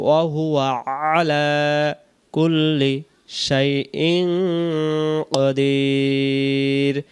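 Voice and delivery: slow, drawn-out tajweed chant; Quran recitation. Shown as a demonstration. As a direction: recite it like a qari, very slowly. (ধীর তেলাওয়াতের সুরে)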